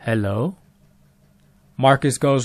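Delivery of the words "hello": low, soft questioning hello; mysterious caller voice. Retold or imitated. Mysterious voice answering Marcus on the phone, low and soft. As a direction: imitate an eerie caller, pitched down and quiet. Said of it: "there's a voice that goes".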